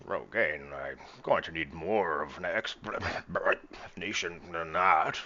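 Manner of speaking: put-on slow, slurred drunken captain; Greybeard, drunken. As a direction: drag the words out like a tipsy captain demanding answers. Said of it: "do my best drunken Greybeard voice".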